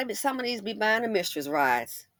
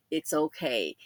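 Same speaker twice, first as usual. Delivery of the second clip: ordinary talk, nothing marked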